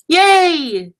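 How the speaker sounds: loud, high, drawn-out yay; excited cheer. Real, not acted. Crafter cheering the confetti flinger working, excited. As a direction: cheer as the trick pays off.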